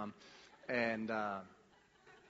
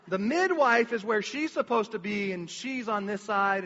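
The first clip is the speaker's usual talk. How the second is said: ordinary talk, nothing marked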